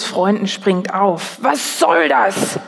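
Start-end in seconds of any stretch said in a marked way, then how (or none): 1.4-2.6 slow, higher, indignant snap; a disciple scolds the mother; scolding. A narrator's reading. Jesus' friend scolding the mother, slow and indignant. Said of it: "schimpft er".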